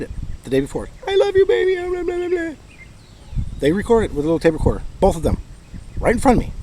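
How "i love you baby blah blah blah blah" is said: high, sugary lovey-dovey voice, trailing into blah blah; mock sweet voicemail. Mocking the voicemail he played for the cops, in a high sugary voice. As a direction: parody a syrupy phone message, much higher.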